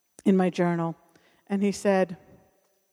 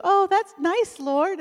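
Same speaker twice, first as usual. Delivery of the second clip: high, sing-song church lady voice; mock sweet response; 10 semitones higher, slower than usual. Woman retelling her friend answering God, high sing-song. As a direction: chirp it up high, delighted. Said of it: "and she goes".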